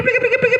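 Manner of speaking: high squeaky prik prik; dragonfly sound. Shown as a demonstration. Ustadz imitates a dragonfly for the children, high squeaky chirps. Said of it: "itu capung kecil".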